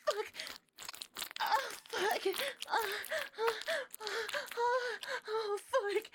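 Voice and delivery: high, loud orgasmic cries; building to a screaming climax. Acted. Climax, gonna come, gonna scream.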